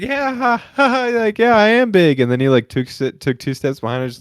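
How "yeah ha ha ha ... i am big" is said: high, forced nervous laughing reply; awkward fake laugh. Guy retelling his answer to a stranger, forced laugh. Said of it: "I was like".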